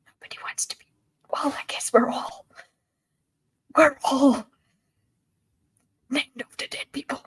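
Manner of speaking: whispered chat for ASMR. Real, not acted. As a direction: keep every word unvoiced.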